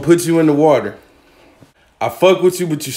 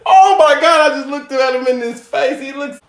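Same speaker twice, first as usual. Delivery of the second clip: high loud shriek of alarm; freaked out; 13 semitones higher, 5 dB louder than usual. Real, not acted. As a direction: squeal in alarm. Looks lobster in face, shrieks.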